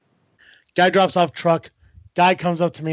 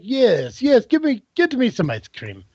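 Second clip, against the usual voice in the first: imitating a European accent, higher on the second yes; 5 semitones higher, slower than usual. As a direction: lightly mimic a European man's accent, eager for ice cream. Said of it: "Or however he says it. He's from Europe".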